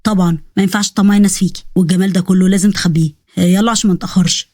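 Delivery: low, fond man's voice; Osman, protective suitor. Acted.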